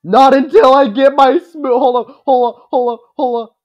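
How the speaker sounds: high, loud excited outburst. Real, not acted. Player stops and corrects himself.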